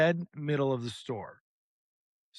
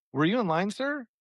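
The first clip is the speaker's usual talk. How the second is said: lightly voiced cashier, sir pitched much higher; mock cashier; 7 semitones higher than usual. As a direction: play a prim cashier with a high sir.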